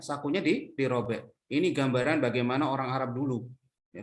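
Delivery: ordinary talk, nothing marked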